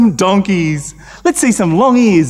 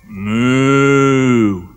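lowing moo like a cow; 6 semitones lower than usual. Acted. Storyteller voices the cows on the ark, mooing. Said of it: "The cows went".

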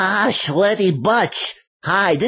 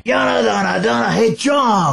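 stoner buddy calling out; Cheech. Acted. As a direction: Chicano stoner hollering for a pal.

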